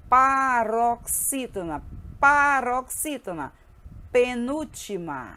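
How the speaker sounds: drawn-out, deliberate syllables; teacher slowly drilling a term. Shown as a demonstration. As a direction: sound out the term slowly for a class. Teacher spells out new term.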